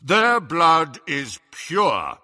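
slow, loud pronouncement; weighty, drawn-out authority; impressive booming voice. Acted. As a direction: declare it to the whole hall. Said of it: "his deep voice echoed impressively".

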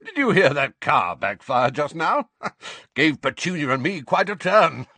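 loud, high forced cheer; Uncle Vernon voice. A narrator's reading. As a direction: fake jolly bluster for the neighbours. Vernon fake-cheerful to neighbours.

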